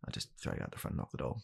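ordinary delivery

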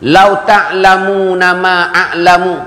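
slow Arabic recitation with long vowels; hadith recitation. Real, not acted. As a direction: recite it slowly, phrase by phrase. Repeats hadith before translating it.

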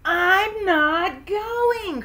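high, loud, very slow refusal; crying out. A narrator's reading. Froggy refuses the movie trip with Dad, crying out. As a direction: dig in, dragging every word out. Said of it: "cried Froggy".

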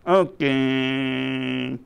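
long, drawn-out puppet reply; lightly voiced puppet drone; puppet character. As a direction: stretch it out as a reluctant puppet. Puppet Mikey answers the host.

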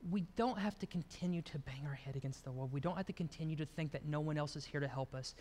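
low, very soft preaching; hushed reassurance. Real, not acted. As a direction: drop to a gentle, intimate hush. Tender moment after child's plea.

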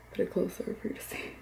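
soft unvoiced aside; whisper. Real, not acted. Filming the album, a quiet whispered aside to herself. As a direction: breathe it under your breath. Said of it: "whispered aside".

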